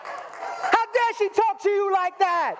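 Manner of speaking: hammed-up, very high indignant yell; disproportionate outrage. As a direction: blow up in mock outrage, drawn out and sky-high. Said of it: "in a disproportionate kind of a way".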